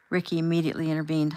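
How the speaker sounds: ordinary delivery, nothing marked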